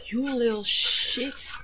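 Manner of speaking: slow hissed whisper; seething whispered insult; whispered curse. Real, not acted. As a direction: curse them under your breath. Cursing attackers under breath.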